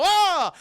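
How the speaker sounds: sudden high yelp, loud; a short loud cry of alarm; startled scream. Retold or imitated. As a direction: shriek in fright. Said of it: "They go".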